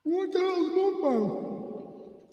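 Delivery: high, slow puzzled auntie; aunt asking about mukbang in a high, slow mock voice; confused auntie voice. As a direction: drag it out like a baffled old aunt.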